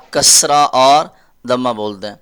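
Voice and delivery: normal talking voice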